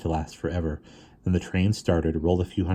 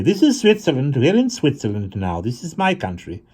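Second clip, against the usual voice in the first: comfortable Swiss lady; 8 semitones higher, 5 dB louder than usual. A narrator's reading. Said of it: "she said comfortably".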